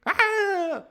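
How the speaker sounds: high, loud squawk; mock bird-flock shriek; imitated bird cry. Shown as a demonstration. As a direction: screech like a startled marsh bird. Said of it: "darkening heaven with a simultaneous whir".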